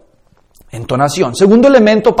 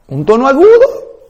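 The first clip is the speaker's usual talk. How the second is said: high pitch; 6 semitones higher than usual. Shown as a demonstration. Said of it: "Varía la entonación".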